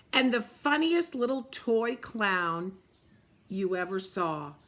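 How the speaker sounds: ordinary delivery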